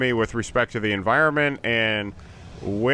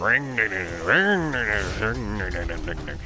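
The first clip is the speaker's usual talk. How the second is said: chainsaw revving noise. Retold or imitated. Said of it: "everybody starts revving their chainsaws".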